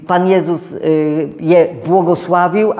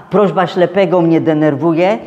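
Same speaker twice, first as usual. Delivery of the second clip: ordinary talk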